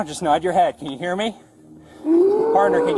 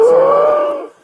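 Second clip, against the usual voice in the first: urgent call; 10 semitones higher, 6 dB louder than usual. Real, not acted. Officer calling bystander for help.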